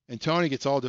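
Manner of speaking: ordinary delivery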